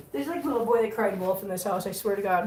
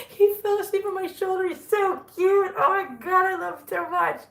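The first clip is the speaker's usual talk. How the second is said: gushing; 8 semitones higher than usual. Real, not acted. Gushing over the sleeping kitten.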